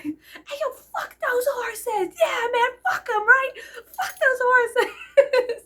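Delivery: high, loud screams breaking into laughter; retold screaming. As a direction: yell insanely while laughing. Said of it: "just screaming".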